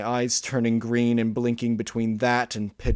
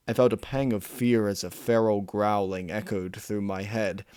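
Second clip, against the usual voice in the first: ordinary delivery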